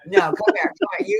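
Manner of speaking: playful high-pitched beckoning; insistent high call. Real, not acted. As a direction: call him over, squeaky and pressing. Insisting Cody come over.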